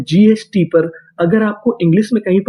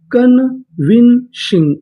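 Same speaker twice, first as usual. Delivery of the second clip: slow syllable by syllable; 3 semitones higher, much slower than usual. Shown as a demonstration. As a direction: stretch convincing out slowly, each syllable held. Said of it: "पूरी meaning".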